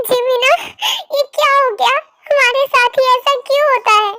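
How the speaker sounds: high, tearful lament; crying. Acted. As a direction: cry out to her twin in grief. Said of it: "ऐसे रो मत".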